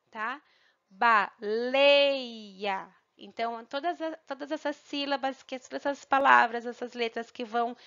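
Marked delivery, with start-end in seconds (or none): 0.9-2.9 "Baleia" with LEI long and strong; stressed syllable. Shown as a demonstration. Teacher showing pupils the stronger sound, stress on LEI. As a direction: say ba, then stretch out LEI before the final a. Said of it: "têm um som mais forte".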